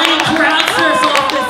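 usual speaking voice

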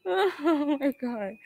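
soft, high, slow whimper; scared. Real, not acted. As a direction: near tears with fright. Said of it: "I'm scared as fuck".

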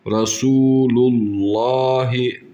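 Rasulullahi slow, the lam vowel drawn out; stretched recitation. Shown as a demonstration. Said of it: "Lamı uzatıyoruz". (Rasulullahi lamı uzatarak)

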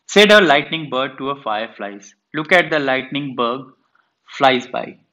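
reading a poem aloud to a class. Shown as a demonstration. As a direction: recite it like a teacher reading to pupils. Teacher reads poem aloud.

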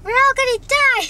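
hammed-up horror panic; panicked scream. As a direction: shriek it like doom is here. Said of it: "is like".

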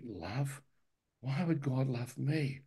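soft, awed whisper of a question; hushed disbelief. Retold or imitated. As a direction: ask it under your breath, unable to believe it. Stunned inner questioning in testimony.

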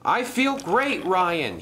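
high, upbeat call; cheerful shout. Acted. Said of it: "he shouted".

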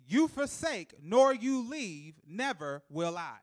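very slow, loud, each word set apart; slow reversed reading. Shown as a demonstration. Preacher reading the verse reversed, slow and loud. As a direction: spell it backwards slowly. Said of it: "Reverse it".

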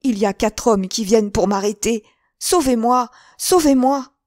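loud, high plea for rescue; frantic cries of a hunted man; desperate cry. A narrator's reading. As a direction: beg for your life, urgently and loudly. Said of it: "s'écria-t-il".

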